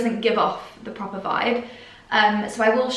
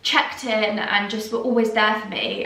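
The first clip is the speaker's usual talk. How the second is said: usual speaking voice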